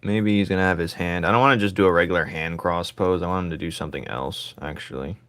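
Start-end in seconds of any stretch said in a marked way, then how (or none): none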